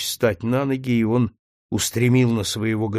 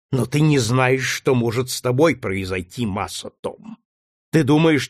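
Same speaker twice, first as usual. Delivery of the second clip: higher, louder cruel threat; menacing threat; 6 semitones higher, 4 dB louder than usual. A narrator's reading. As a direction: frighten him with torture. Legree threatening torture.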